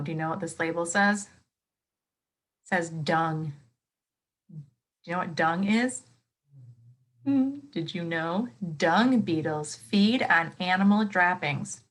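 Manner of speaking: ordinary talk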